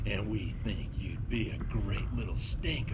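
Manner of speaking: whispered villain voice; sinister whisper. Acted. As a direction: hiss it like a villain. Skunk King speaks.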